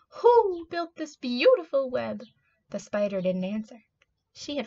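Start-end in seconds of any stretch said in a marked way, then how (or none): 0.1-2.2 high, slow, hooting owl voice; owl character. A narrator's reading. Owl asking who built the web, high slow hooting voice. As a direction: draw out the question like an owl. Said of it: "asked the owl".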